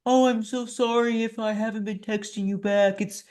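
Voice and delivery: mocking a Facebook rant; mock whiny poster. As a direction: whine like a self-pitying dieter. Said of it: "where it was like".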